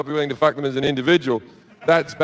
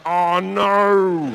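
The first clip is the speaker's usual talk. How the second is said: mock dismay. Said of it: "show me the clip and I'd be like".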